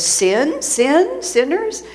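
high, rising query on each word; puzzled questioning. Retold or imitated. Swami's talk querying a mistaken word, puzzled. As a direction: test the word as a question, pitch climbing.

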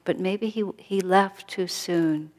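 ordinary talk, nothing marked

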